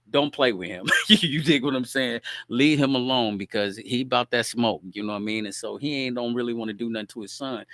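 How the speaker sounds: ordinary delivery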